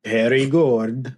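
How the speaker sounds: slow, hammed-up Italian-accented "Very good"; mock Italian accent on "Very good". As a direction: savor "Very good" like an Italian chef. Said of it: "with, like, an Italian accent".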